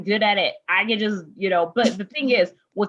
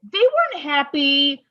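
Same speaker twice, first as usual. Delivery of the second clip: higher, teasing scold to your younger self; sassy self-lecture; 6 semitones higher than usual. Retold or imitated. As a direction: wag a finger at the dreamer, half laughing. Lecturing her past self, sassy.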